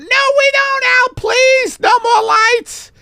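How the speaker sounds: very high, loud falsetto begging; hammed-up panic; desperate pleading. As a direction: shriek for mercy from the lights. Millie begs for no more lights.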